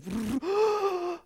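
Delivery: a high, startled intake of breath; sharp gasp. Acted. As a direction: gasp in sudden recognition. Joshua recognizes the holy moment.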